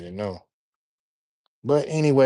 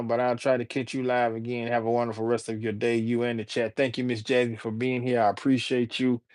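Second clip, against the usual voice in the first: ordinary talk, nothing marked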